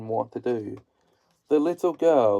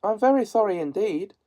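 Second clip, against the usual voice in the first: high, fast, flustered apology; frightened girl; 8 semitones higher, faster than usual. A narrator's reading. Dorothy apologizes to the melting witch, high and fast. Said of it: "truly frightened".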